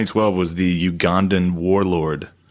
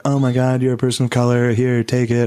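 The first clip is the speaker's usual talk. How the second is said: mock gushing apology; 3 semitones higher than usual. Imagined apology to neighbour.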